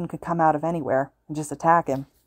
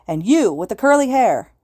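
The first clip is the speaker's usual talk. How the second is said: calling out; 5 semitones higher, 6 dB louder than usual. A narrator's reading. Student calls out Midoriya.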